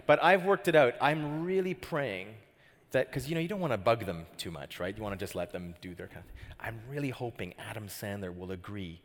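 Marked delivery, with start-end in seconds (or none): none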